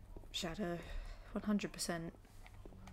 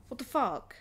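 ordinary talk

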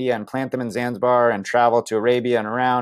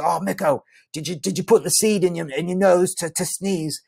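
higher, eager mate voice; excited friend; 8 semitones higher than usual. Retold or imitated. Friend Brian urging the narrator, lightly voiced high eager mate. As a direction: play the friend, keen and high.

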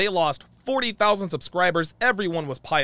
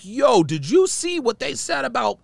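higher-pitched, agitated retelling; pissed off; 7 semitones higher than usual. Retold or imitated. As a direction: replay a worked-up phone call. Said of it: "I was so pissed off".

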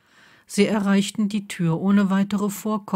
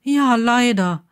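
impatient, louder and higher; 5 semitones higher, 6 dB louder, slower than usual. Acted. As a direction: brush him off, slowly. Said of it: "sagte Blaze ungeduldig".

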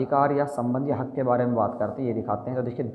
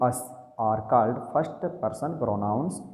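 slow dictation; much slower than usual. Shown as a demonstration. Dictating the definition slowly.

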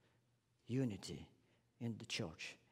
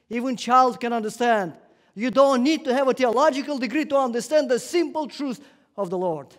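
higher, louder preaching burst; 8 semitones higher, 11 dB louder than usual. Real, not acted. Preacher to congregation, loud fervent proclamation. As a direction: hammer home the simple truth. Preacher driving home simple truth.

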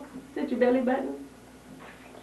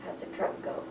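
whispering; 9 semitones lower, 11 dB quieter than usual. Real, not acted. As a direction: hush it to the little one. Mother hushing to toddler.

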